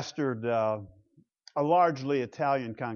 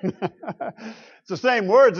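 laughing; 6 semitones higher, 7 dB louder, much slower than usual. Real, not acted.

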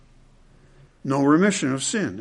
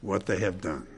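hushed; 8 semitones lower, 11 dB quieter than usual. Acted. Sermon, hushed repetition.

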